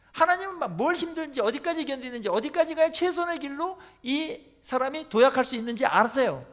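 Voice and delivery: normal talking voice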